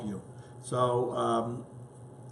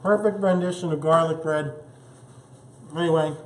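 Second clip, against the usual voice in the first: mouth full; 5 semitones higher, 5 dB louder than usual. Real, not acted. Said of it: "I'm not gonna talk with my mouth full".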